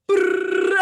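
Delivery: loud trilled phone vibration; phone buzz imitation. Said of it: "my phone next to me goes".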